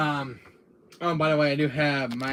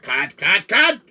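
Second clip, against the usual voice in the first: rising, louder repeated shout; director calling cut; 5 semitones higher, 9 dB louder, slower than usual. Acted. Director stopping Wesker's scene, escalating shout. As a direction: call a halt, climbing each time.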